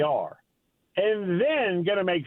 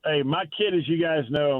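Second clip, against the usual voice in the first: normal talking voice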